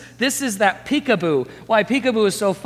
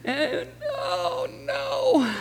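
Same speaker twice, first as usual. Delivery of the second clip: put-on high, wailing, sobbing toddler voice; sobbing toddler wailing over his lost blankie; crying toddler; 4 dB louder, much slower than usual. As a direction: blubber it out like a heartbroken little kid. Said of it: "you know how toddlers sound when they're crying".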